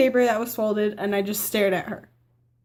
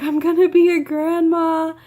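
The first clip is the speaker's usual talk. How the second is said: higher, louder, gushing; excited mom; 6 semitones higher, 5 dB louder than usual. Retold or imitated. Mom realizing she'll be a grandma, excited. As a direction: announce it like a thrilled new grandma. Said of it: "my mom was like".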